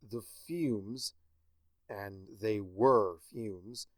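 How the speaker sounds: usual speaking voice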